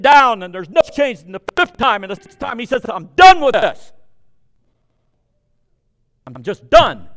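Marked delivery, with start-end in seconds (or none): 2.9-7.1 loud, fed-up outburst; exasperated outburst. Retold or imitated. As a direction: throw up your hands and shout you're done. Naaman giving up, exasperated.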